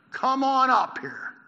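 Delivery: loud, high, stretched-out call; shouted challenge. Acted. Men from the outpost to Jonathan, slow loud shout. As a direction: shout it slowly down to the climber. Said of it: "men from the outpost shouted to Jonathan".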